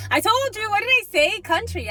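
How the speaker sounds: very high, loud triumphant outburst; excited. Real, not acted. Reader vindicated about the country accent, excited. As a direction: crow it, far up in pitch.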